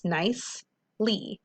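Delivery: "nicely" slowly broken into its syllables; split into syllables. Shown as a demonstration. Teacher to students, breaking "nicely" apart slowly. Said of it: "breaking nicely apart".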